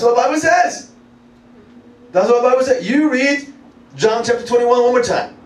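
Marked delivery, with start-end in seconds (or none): none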